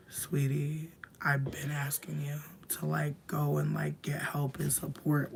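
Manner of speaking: soft, breathy whisper as if saying it to them; hushed quote to ex. Retold or imitated. Telling the ex who is leaving, in a whisper. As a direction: whisper it like a pointed aside. Quoting what she told her ex.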